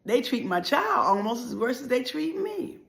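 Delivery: normal talking voice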